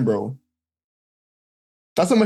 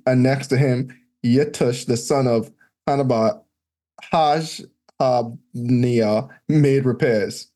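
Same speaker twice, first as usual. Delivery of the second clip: scripture reading; 6 semitones lower than usual. Acted. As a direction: read out the old names like a lector. Reads scripture list aloud.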